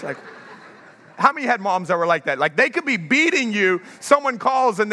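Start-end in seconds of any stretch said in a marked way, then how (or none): none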